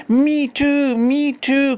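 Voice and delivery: put-on high eager child voices; children crying out. A narrator's reading. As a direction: squeal it like excited kids. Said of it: "cried the children".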